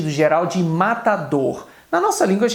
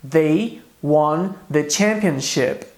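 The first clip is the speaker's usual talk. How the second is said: slow, clear English example read for students; slow model sentence; much slower than usual. Shown as a demonstration. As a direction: say each word slowly for the class. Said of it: "Em inglês isso fica". (frase de exemplo lenta e clara)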